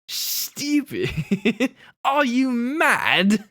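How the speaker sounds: high, hammed-up British outrage. Shown as a demonstration. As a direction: overdo the British accent, high and drawn out. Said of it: "most stereotypical way I possibly could".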